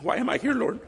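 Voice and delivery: a missionary overwhelmed, asking God why; pleading prayer. Retold or imitated. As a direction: cry out to the Lord in bewilderment. Retold prayer in Africa.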